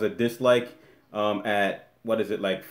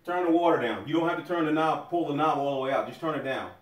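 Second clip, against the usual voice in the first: raised, higher voice calling from the room; calling out, annoyed; 4 semitones higher, 7 dB quieter than usual. Real, not acted. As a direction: tell the daughter to turn the water down, insistent. Keeps calling to daughter in shower.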